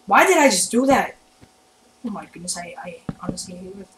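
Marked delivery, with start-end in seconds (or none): 2.0-3.9 very soft, whispered dismay; deflated whisper. Real, not acted. Gamer regretting his own move, deflated whisper. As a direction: whisper it to yourself, defeated. Dejected after own mistake.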